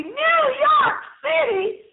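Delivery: high, drawn-out shout of excitement; slow, high-pitched, over-the-top cheer; thrilled exclamation. Real, not acted. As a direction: belt out the city name slowly, way up high. Repeats city name in excited exclamation.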